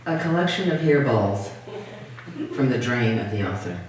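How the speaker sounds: measured, deadpan list of titles; poem reading. Acted. Poet reading invented titles to an audience, measured poem reading. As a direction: let each title stand alone, with long pauses.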